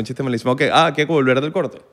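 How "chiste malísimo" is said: ordinary talk, nothing marked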